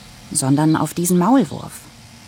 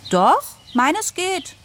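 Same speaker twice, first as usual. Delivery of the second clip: much higher, slow, feigned innocence; innocent girl's fib; much slower than usual. Acted. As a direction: sell the lie sweetly. Daughter lies to Papa. (gespielt unschuldige Mädchenstimme, viel höher, langsam)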